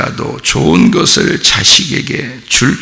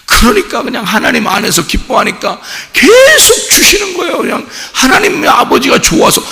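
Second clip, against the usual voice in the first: higher and louder, joyful fervor; exultant preaching; 13 semitones higher, 4 dB louder than usual. Acted. Pastor exulting to the church about a giving God. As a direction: lift it up with joy.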